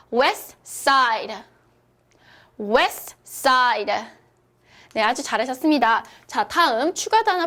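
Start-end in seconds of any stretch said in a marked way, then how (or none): 0.1-3.9 "West Side" modelled as wes, side, T silent; dropped T. Shown as a demonstration. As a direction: demonstrate "West Side" for repeating, no T. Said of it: "우리 발음 크게 한번 해볼게요". ("West Side"를 T 없이 또박또박)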